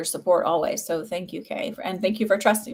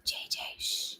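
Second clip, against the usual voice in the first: hushing whisper; 15 dB quieter than usual. Real, not acted. Streamer shushing her dog off mic, whispered. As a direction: whisper "J J, shh" under your breath.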